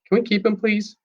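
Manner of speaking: high, pleading whine; whiny little kid. Acted. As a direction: beg like a small child. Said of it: "I sound like a whiny little kid".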